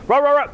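high, loud mock barking. Scared dog bluffing with high loud mock barks. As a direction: bark like a bluffing chicken dog. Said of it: "chicken dog bluffing".

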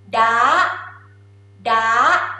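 "da" pushed upward, ending high; high tone, ladylike. Shown as a demonstration. Teacher showing Thai high tone, ladylike "da". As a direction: lift "da" like a lady's voice. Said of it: "High tone is sound like".

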